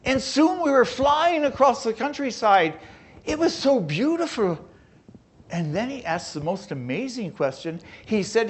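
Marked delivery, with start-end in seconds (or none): 0.1-4.6 higher and louder, full of wonder; lifted delight recalling the train ride. Retold or imitated. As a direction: relive the thrill of the ride. Recalling flying across countryside.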